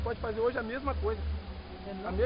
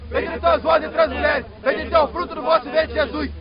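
the Lord's Prayer and Hail Mary yelled loud and together; shouted team prayer; 3 semitones higher, 12 dB louder than usual. Real, not acted. Players in the huddle shouting the prayer before kickoff, at full volume. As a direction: roar the prayer like a war cry.

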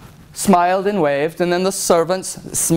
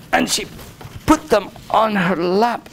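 ordinary delivery, nothing marked

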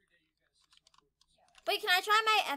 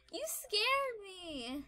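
ordinary talk

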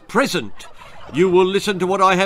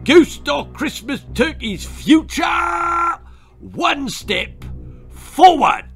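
very high, drawn-out military shout; sergeant's roaring summons; frightening bellow; 13 semitones higher, 6 dB louder, slower than usual. A narrator's reading. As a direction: bellow a terrifying parade command. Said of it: "bellowed in his most frightening voice".

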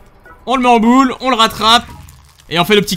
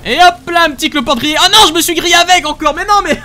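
flustered; 9 semitones higher, 3 dB louder than usual. Real, not acted. Burns himself grilling woodlouse.

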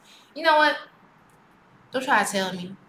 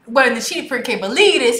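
ordinary delivery

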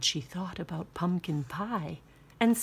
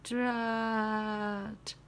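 mummy drat; 3 semitones higher, 4 dB quieter than usual. Acted. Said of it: "said the mummy".

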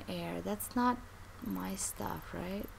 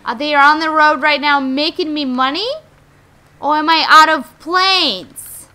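loud, raised, outraged questioning; indignant yelling; 5 semitones higher, 13 dB louder than usual. Pretend. Player ranting about planes not making money, indignant yelling. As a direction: demand answers at full volume.

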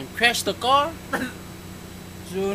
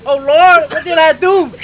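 high, loud mock lament; hollering at the trees; 9 semitones higher, 13 dB louder than usual. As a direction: imitate a woman crying out to the trees. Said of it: "she talked to the trees".

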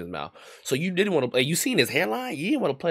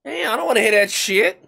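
higher and louder furious rant; angry shout; 11 semitones higher, 8 dB louder than usual. Real, not acted. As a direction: tell him off at full volume. Heated argument with friend.

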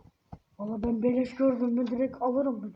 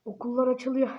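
ordinary talk

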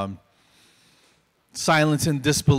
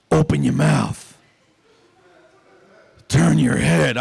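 slow, gruff orders that sound hostile; aggressive lost voice; 2 semitones higher, 5 dB louder, slower than usual. Retold or imitated. Hygienist to patient, accidentally aggressive commands. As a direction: bark the simple instructions, a bit louder. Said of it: "everything I said came out very aggressive".

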